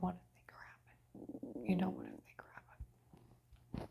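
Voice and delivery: slow fearful whisper. Real, not acted. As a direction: barely breathe the worry out. Fearful thought about the package.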